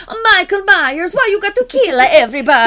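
put-on high, loud hammy Italian accent. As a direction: ham up a cartoon Italian voice. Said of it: "doing an Italian accent".

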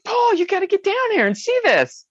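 high-pitched, astonished summons; excited calling out. Retold or imitated. Wife calling her husband down to look, astonished. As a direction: call your husband downstairs to see.

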